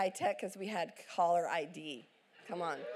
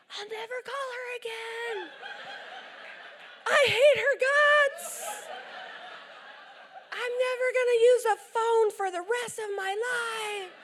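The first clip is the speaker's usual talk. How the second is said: hammed-up heartbroken teen, much higher and loud, laughing through it; melodramatic teen wail; 13 semitones higher, 4 dB louder than usual. Preacher imitating her son writhing on the floor over his girlfriend. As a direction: wail it like a tragic soap star. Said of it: "writhing on the floor like a snake going".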